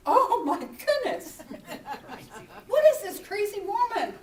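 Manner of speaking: loud, high mock alarm; recoiling in alarm. Two strangers recoiling from a woman, hammed-up alarm. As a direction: imitate startled strangers, voice jumping up. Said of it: "they sort of recall, recoiled, like".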